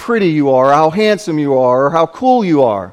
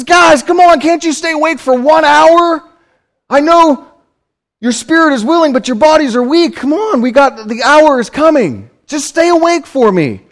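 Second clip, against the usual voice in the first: much higher, urgent, pleading voice; pleading scold; 8 semitones higher than usual. Retold or imitated. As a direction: shake the sleepy friends awake, half begging. Jesus scolds sleeping disciples.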